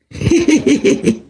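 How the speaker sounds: breathy teasing heh-heh laugh; sly chuckle. Acted. Xu Liang crouches and chuckles at Jiang Zhao, sly breathy laugh. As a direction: chuckle down at the fallen man. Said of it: "冲蒋昭一乐".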